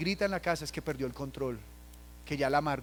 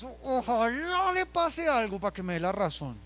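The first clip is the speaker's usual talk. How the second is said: resentful wish; 8 semitones higher, 4 dB louder than usual. Retold or imitated. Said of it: "El padre a veces en la ira".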